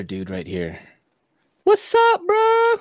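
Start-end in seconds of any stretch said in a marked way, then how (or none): 1.6-2.7 much higher, louder hype greeting; excited greeting. Real, not acted. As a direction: greet him with big energy. Excited greeting to a new guest.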